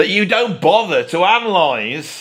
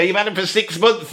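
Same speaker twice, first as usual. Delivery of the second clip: ordinary delivery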